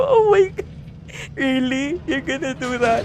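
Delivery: high, amused disbelief, retold; mock shocked oh my God. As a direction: replay your shocked reaction with a laugh. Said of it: "Sabi ko". (gulat na gulat, pabiro)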